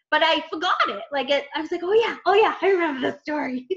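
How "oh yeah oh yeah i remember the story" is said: higher and louder, eager; delighted to remember it all; a rush of happy recognition; excited recognition. Retold or imitated. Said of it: "I just got all excited again".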